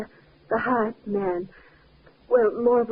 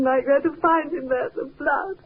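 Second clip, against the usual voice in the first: crying; 3 semitones higher than usual. Acted. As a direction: let the sob break the word. Wife in shock over husband.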